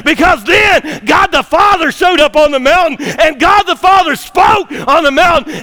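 loud, high, fervent sermon shouting; preaching at full force. Acted. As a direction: preach it out, rising to a roar. Preacher telling Transfiguration story.

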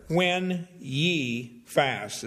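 very slow "When ye fast", stress on "When"; emphatic quote. Shown as a demonstration. Pastor stressing the verse to congregation, slow emphatic. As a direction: draw "When ye fast" out word by word. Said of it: "is the matter".

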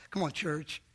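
ordinary delivery

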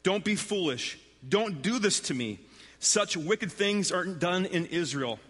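a touch of urgent protest, read aloud; narrated pleading cry. A narrator's reading. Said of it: "she cried".

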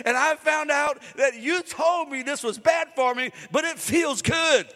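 fired-up preacher proclaiming; full-force preaching. Acted. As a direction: build the crowd up and lean on every word.

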